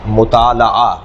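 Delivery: مطالعہ with zabar on the lam, mu-taa-la-ah; correct pronunciation. Shown as a demonstration. Teacher explaining zabar on lam, correct pronunciation of مطالعہ as mu-taa-la-ah. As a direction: say مطالعہ slowly with an open "la". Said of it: "لام پر زبر ہے".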